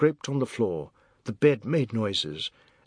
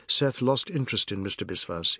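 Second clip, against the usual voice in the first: ordinary delivery, nothing marked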